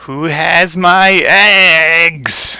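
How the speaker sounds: high, long drawn-out bunny wail. Acted. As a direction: play a giant bunny demanding its eggs, slowly. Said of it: "It just has an interesting voice".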